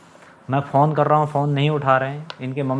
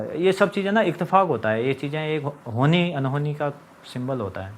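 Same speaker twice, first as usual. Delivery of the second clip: normal talking voice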